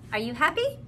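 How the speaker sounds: normal talking voice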